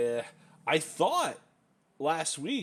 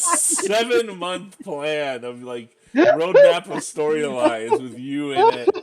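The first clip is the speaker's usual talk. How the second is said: loud, high excited storytelling; excited loud retelling; 8 semitones higher, 13 dB louder than usual. Retold or imitated. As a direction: tell it loud and worked up.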